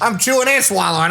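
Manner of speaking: high, chewed-up attempt at an Australian accent. Shown as a demonstration. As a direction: chew the words, badly faking Australian. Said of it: "like you're chewing on it".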